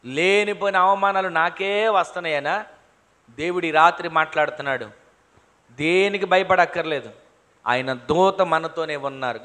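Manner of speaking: normal talking voice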